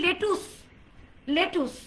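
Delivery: লেটুস as "le-TOOS", with a clear U; common mispronunciation. Shown as a demonstration. Teacher showing how we say lettuce, common mispronunciation. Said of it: "আমরা বলি".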